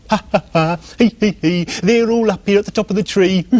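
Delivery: high, mocking squirrel cackle; cheeky squirrel laughing down at the pig; giggling squirrel. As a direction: tease the pig from the treetop with laughter. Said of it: "giggling high above him".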